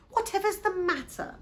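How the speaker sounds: high-pitched acted puzzlement; concerned question. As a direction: ask it high, full of concern. Mum asks about crying baby.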